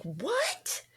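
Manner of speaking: soft, high, rising retold "what"; stunned disbelief. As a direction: act out hushed shock, pitch climbing. Retold shock at hospital news.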